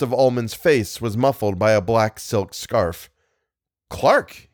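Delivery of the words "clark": sudden high cry of a name; startled exclaim. A narrator's reading. Startled man exclaims on seeing his friend, sharp cry. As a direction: jump at the sight of a friend. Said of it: "he exclaimed".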